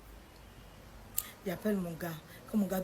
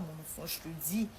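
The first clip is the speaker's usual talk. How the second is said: ordinary talk, nothing marked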